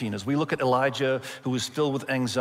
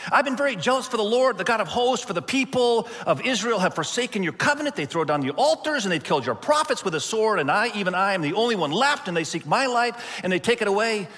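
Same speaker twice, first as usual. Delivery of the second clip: anxious complaint; 7 semitones higher than usual. Retold or imitated. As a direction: pour out the complaint in a panic. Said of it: "I can only imagine that this is how Elijah answers".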